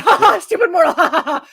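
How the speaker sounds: loud, high mock laughter; hammed-up scornful guffaw at a puny mortal; gloating god. God sending the mortal away, mocking villainous laugh. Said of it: "What is this mere mortal gonna do".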